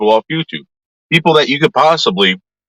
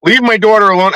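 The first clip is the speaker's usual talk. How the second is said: higher, mock-shrill "Leave my daughter alone"; imitating angry mother; 6 semitones higher than usual. Quoting the mother's threat in a mocking voice. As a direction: parody her threat. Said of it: "screaming at me, threatening me".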